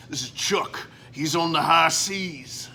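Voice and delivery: a lightly put-on old salt, not far from his own; sailor voice. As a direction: growl it out like a hint of an old sea dog. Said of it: "I turn into my sailor voice".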